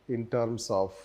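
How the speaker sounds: measured note-dictation with long pauses. Real, not acted. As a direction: dictate phrase by phrase, leaving time to write. Dictates notes, repeating each phrase.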